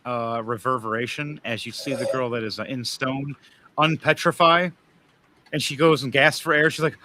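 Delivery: usual speaking voice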